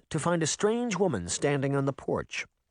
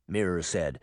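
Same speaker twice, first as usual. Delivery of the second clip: ordinary delivery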